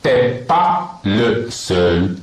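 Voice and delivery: T'es pas le seul drawn out very slowly; very slow demo. Shown as a demonstration. Teacher slowly modelling T'es pas le seul for students. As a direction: say it slowly, word by word. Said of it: "تي با لو سول".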